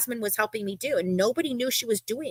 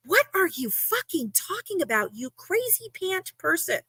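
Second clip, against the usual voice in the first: incredulous retort; 8 semitones higher, 4 dB quieter than usual. Retold or imitated. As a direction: retell a baffled, angry comeback. Retelling angry reply to crazy message.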